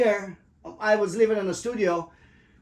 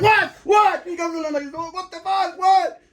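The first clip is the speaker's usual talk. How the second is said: high, loud imitated shouting; mock yell; 9 semitones higher, 5 dB louder, slower than usual. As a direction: bark back like the angry roommate. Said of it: "he goes like".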